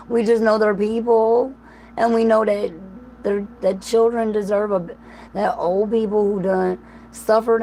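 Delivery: pleading on the edge of crying; near tears. Real, not acted. Grieving for war victims to her viewers, near tears. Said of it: "I'm crying over Palestinians".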